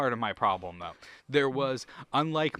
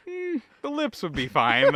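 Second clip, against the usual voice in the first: higher, louder smug voice; mock suave quote; 11 semitones higher, 6 dB louder than usual. Retelling the movie line, high and smug. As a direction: purr it like a cheesy flirt. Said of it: "And he goes".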